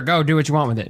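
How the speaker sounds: normal talking voice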